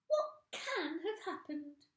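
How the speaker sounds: slow, high anxious crone voice; high puzzled old woman. A narrator's reading. Mrs Twit staring at her stick, high and anxious. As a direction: wonder aloud in a high worried voice.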